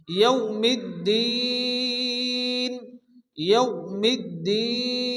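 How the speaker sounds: ইয়াউমিদ্দিন slowly, ending held long; four-count stretch. Shown as a demonstration. Teacher demonstrating the long stretch. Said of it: "চার আলিফ পরিমাণ টান দিয়ে পড়তে হবে".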